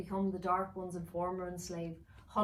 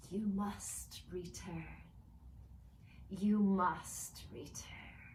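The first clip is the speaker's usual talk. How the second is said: slow, haunting, otherworldly call; eerie elf voice; much slower than usual. Acted. Tormented elf calling to the children, slow and eerie. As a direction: summon them back like a ghost.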